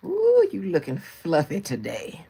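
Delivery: slow, loud mock old-lady tease; shady old lady. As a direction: savour the fake compliment. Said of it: "I'ma let y'all young girls have it".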